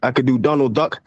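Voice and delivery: ordinary talk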